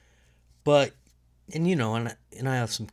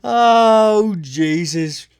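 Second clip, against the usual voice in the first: startled exclamation; 9 semitones higher, 11 dB louder, much slower than usual. Real, not acted. Sudden loud outburst.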